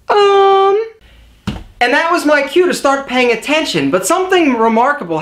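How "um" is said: high, loud mocking "Um"; friend's pointed "um". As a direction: call him out with a sharp "Um". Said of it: "she would say".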